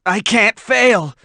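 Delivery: cling to the thought of his sister; strained, high desperation; desperate. Acted. Desperate knight in battle.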